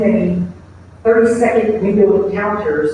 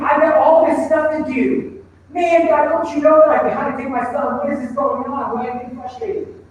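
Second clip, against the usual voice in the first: frustrated inner voice; 7 semitones higher than usual. Retold or imitated. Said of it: "I'm sitting there getting frustrated".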